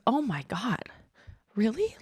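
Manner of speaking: hushed, breathy imitation of being moved; choked up. Student hears kind words, imitated as choked up and hushed. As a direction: retell it in a choked near-whisper. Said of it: "he got choked up and he said".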